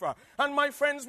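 usual speaking voice

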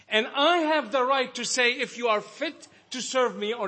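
high, loud, ringing sermon voice; full-force preaching. Acted. Preacher urging the brethren, high and loud. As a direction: preach it out, climbing with fervor.

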